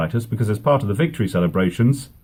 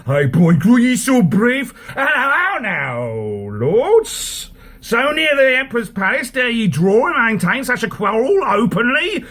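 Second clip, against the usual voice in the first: scolding villain; 10 semitones higher, 3 dB louder than usual. Acted. Aaron breaks up the fight.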